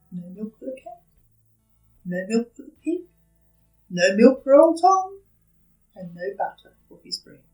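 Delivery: plain reading voice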